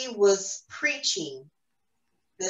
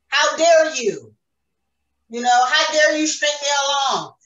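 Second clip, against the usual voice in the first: raised, indignant outburst at God; loud, higher, affronted challenge; upset, indignant; 5 semitones higher, 4 dB louder than usual. Retold or imitated. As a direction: throw it out as a hurt accusation. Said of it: "that was my feeling. Like, I was very upset".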